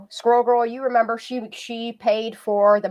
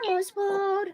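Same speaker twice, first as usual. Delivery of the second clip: Elmo's World in a much higher, squeaky puppet voice; 13 semitones higher than usual. Shown as a demonstration. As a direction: pop up into a high Elmo squeak. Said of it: "we used to do Elmo".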